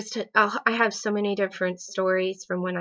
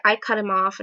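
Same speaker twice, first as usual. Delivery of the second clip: ordinary delivery, nothing marked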